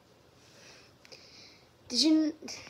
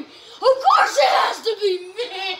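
loud, higher outburst with a laugh in it; exasperated shout; 5 semitones higher, 7 dB louder, slower than usual. Real, not acted. Player complaining about his bad luck in the game, exasperated shout. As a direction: yell it in disbelief, dragging it out.

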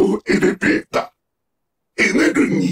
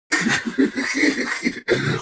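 soft villainous snicker; sinister snicker; 5 dB quieter than usual. Acted. Villain mocking X's efforts, soft sinister snicker. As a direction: snicker quietly to yourself, scheming.

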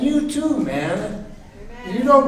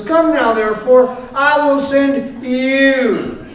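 loud, high, drawn-out summons; God commanding; 5 semitones higher, 4 dB louder, slower than usual. Acted. God sending Moses to Pharaoh, commanding. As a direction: proclaim it as God's command, stretching the words. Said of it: "So he says this to Moses".

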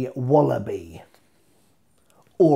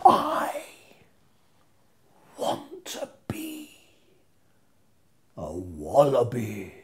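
theatrical swoop; 3 semitones lower, 4 dB quieter, much slower than usual. Acted. Dramatic finish, squeaky then low.

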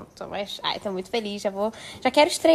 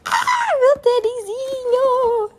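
much higher and louder, stretched slow; delighted squeal; 13 semitones higher, 6 dB louder, much slower than usual. Real, not acted. Showing fans her new sneakers, delighted squeal. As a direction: gush over the new sneakers.

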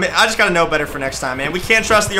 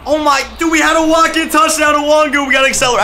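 excited; 7 semitones higher, 3 dB louder than usual. Real, not acted. Missed walk-in touchdown.

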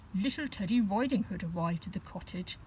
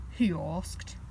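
plain reading voice

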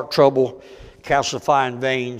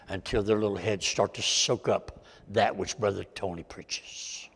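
much softer, lower, close and earnest; intimate sincere appeal; 5 semitones lower, 9 dB quieter than usual. Real, not acted. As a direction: lean in and speak straight to their hearts.

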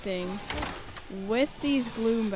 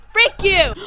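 loud, high angry outburst; angry yell; 8 semitones higher, 10 dB louder than usual. Real, not acted. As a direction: yell it right at the monster. Yells at a game enemy.

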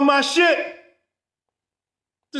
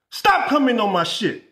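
ordinary delivery

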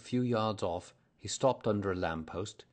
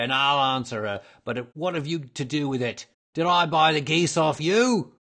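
loud, raised tirade from a stallholder; angry shouting; 6 semitones higher, 11 dB louder than usual. A narrator's reading. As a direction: drive the pest away at full voice. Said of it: "he shouted".

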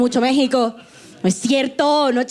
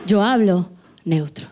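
low, measured radio announcer voice; deep, neutral radio diction; 8 semitones lower than usual. Retold or imitated. Comic reenacting her radio-host self, low announcer voice. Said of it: "yo era locutora de radio y yo decía".

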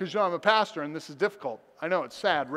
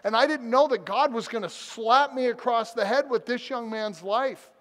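higher, urgent pulpit delivery; impassioned preaching; 6 semitones higher, 3 dB louder than usual. Real, not acted. Pastor confessing to the church, preaching with passion. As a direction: drive the lesson home with rising fervor.

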